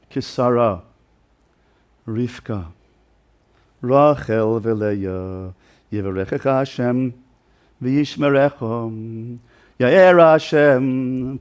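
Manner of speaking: very slow, drawn-out blessing with long pauses; slow heartfelt blessing. Retold or imitated. Father blessing his daughter Rivka, slow and deeply meant. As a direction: give each word its full weight, unhurried. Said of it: "such a long, meaningful, purposeful, emotionally connected blessing".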